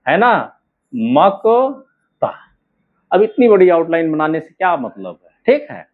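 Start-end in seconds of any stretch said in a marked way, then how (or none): 0.9-2.3 very slow मकता, long gap before ता; drawn-out dictation of मकता for writing; syllable by syllable. Shown as a demonstration. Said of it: "अब पूरा लिखेंगे तो कैसे लिखेंगे".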